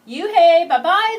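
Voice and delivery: loud, high taunting yell goodbye; kids yelling. Acted. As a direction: yell it after her as she leaves. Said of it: "the kids yelled".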